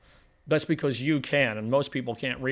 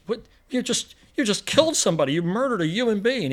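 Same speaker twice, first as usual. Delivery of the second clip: shocked outburst; 7 semitones higher than usual. Retold or imitated. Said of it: "he goes".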